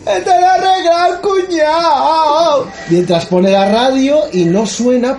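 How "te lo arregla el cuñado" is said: very high, slow comic shout; hammed-up brother-in-law. Comic brother-in-law bragging to the customers, high squealing voice. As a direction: squeal it out like a goofy mechanic.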